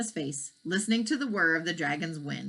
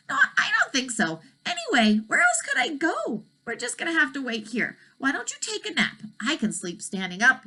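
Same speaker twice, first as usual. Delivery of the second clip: a high, easygoing dragon voice; a patient dragon suggesting Elmer rest; calm dragon; 8 semitones higher than usual. Acted. Dragon tells Elmer to take a nap, high and easygoing.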